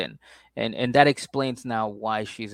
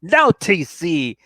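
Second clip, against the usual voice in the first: hammed-up high wailing disbelief, slowed; mock grief over a friend's arrest, pitched high; 10 semitones higher, 8 dB louder, slower than usual. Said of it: "she's like".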